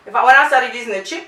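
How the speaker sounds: ordinary talk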